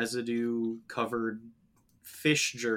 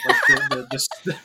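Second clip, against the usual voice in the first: bad Swedish accent, pitched up; hammy Swedish-ish voice; fake Swedish accent; 9 dB louder than usual. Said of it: "That is not a Swedish accent".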